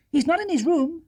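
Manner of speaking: put-on high, bright-pitched girl's voice; young girl Judy. A narrator's reading.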